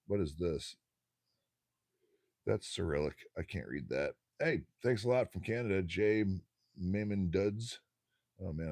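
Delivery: usual speaking voice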